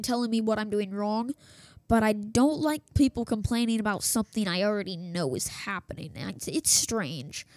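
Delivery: ordinary talk, nothing marked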